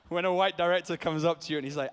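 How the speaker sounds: normal talking voice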